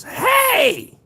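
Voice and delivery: shrill high mock yell from a window; a sudden hammed-up high shout; senior lady yelling. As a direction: call out sharply from above. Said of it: "all I heard was".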